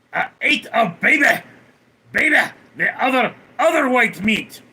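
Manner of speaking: high, loud, unhinged yelling; manic shout. Acted. Streamer answers chat's baby question with a manic shout. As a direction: bellow it like a gleeful lunatic.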